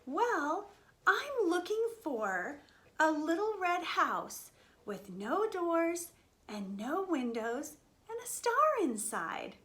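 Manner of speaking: slow, slightly lifted mouse voice; earnest little mouse. Acted. Mouse tells cows his clues, slow lifted voice. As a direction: lay out each clue slowly and clearly.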